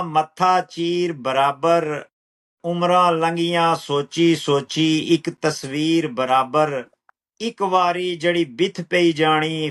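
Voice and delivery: slow, measured verse with drawn-out words; poetry recital. Acted. Poet reciting own lines to an appreciative listener, measured recital.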